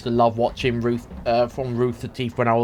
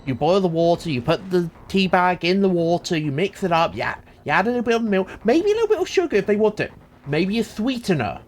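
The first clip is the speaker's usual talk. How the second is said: rushed explainer; 5 semitones higher than usual. Retold or imitated. Narrating the silly tea video.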